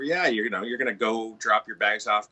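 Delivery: ordinary delivery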